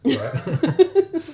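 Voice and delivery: squeaky high punchline. Real, not acted. Punchline squeak after story.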